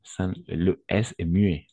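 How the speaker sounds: ordinary delivery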